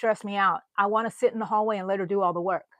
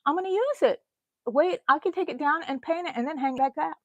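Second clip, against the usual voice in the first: retold own words; 7 semitones higher, faster than usual. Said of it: "So I said".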